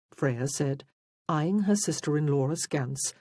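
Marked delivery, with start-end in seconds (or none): none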